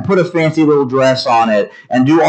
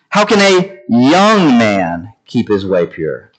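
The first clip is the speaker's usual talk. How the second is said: put-on "young" stretched with a swell in pitch; slowly, leaning hard on "young"; stress on "young"; 2 semitones lower than usual. Shown as a demonstration. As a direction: make "young" the word that matters. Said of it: "I'm emphasizing each word in that sentence".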